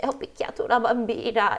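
high-pitched mock last words; mock dying confession. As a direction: act out a dying woman's confession, lightly hammed. Acts the dying woman's last words.

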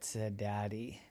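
plain reading voice

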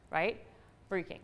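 normal talking voice

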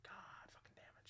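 near-whispered, let-down swearing; muttered frustration. Retold or imitated. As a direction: curse softly under the breath. Muttered reaction to being turned down.

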